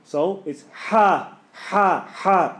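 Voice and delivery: ha said slowly, breathy h right from the start; sharp ha. Shown as a demonstration. Teacher drilling the throaty ha. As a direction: hit the h of ha straight in. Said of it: "the first sound is ha".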